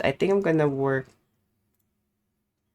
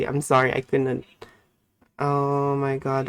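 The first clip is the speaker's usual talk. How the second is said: ordinary delivery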